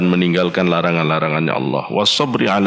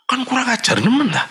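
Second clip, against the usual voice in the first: indignant; faster than usual. Real, not acted. Preacher scolds ungrateful children.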